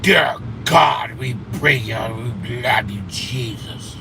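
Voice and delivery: hammed-up prayer, strong start then trailing off; mock prayer. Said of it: "Cora and her praying".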